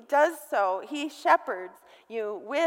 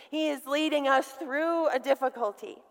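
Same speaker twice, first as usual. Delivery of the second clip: ordinary talk, nothing marked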